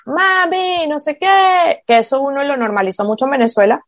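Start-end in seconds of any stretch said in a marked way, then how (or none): 0.1-1.8 a builder's catcall, pitched much higher; mock catcall. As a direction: call out "mami" like a whistling builder. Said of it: "Y era como que el chiflido".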